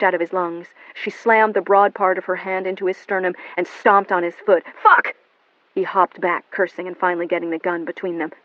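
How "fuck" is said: high, loud pained cry; pained yell. Acted. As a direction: yell it out in pain. William hit in the gut.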